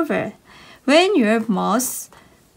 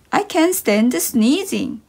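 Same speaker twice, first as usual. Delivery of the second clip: ordinary talk, nothing marked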